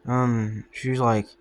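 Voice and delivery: ordinary delivery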